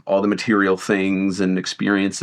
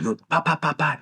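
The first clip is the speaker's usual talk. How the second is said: lightly voiced old Chinese lady; playful mock-accent shopkeeper; imitating a Chinese accent; 6 semitones higher, 3 dB quieter than usual. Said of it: "and she goes".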